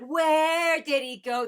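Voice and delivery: high, slow, imitated desperate lyric; desperate plea. Fan imitating singer's lyric, slow high desperate plea. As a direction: echo the singer's searching cry. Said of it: "I love that desperation".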